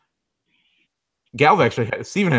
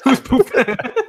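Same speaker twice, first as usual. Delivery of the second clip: mock meme voice; 13 semitones higher, slower than usual. Quoting a meme.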